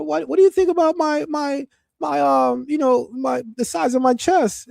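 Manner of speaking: high, sing-song imitation of women chatting; mimicking women. Mocking women asking a friend in high voice.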